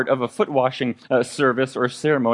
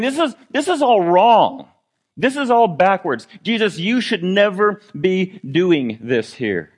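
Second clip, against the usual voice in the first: much higher, loud, pushing against it; indignant protest; 8 semitones higher, 5 dB louder than usual. Retold or imitated. Peter loudly protesting to Jesus, indignant. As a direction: cry out that it's all backwards.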